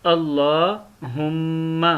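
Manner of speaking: अल्लाहुम्मा slowly, with a long held aa; lengthened vowel. Shown as a demonstration. Teacher repeating the correct reading, अल्लाहुम्मा drawn out. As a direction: stretch the aa in अल्लाहुम्मा. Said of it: "इसको थोड़ा सा खींचते हैं".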